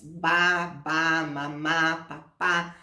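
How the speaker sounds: put-on slow, drawn-out baby syllables ba ba mamá papá; baby babble demo. Shown as a demonstration. Teacher shows parents a baby's first syllables, slow babble. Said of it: "eles vão formando as sílabas".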